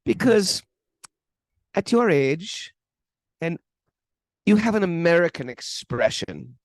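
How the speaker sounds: put-on measured German accent with long pauses; deliberate German-accented counsel. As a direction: imitate a German swami, word by careful word. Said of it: "he says in that German accent".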